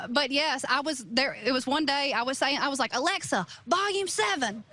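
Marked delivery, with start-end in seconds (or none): 2.9-4.6 punchy, raised command to Alexa; with umph. Retold or imitated. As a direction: give it some umph. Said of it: "you gotta say it with a little bit of umph".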